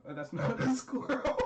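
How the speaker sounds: high, breaking into giggles; real laughing. Real, not acted. Storyteller notices the squirrel mistake, real laughing. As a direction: crack up while correcting yourself.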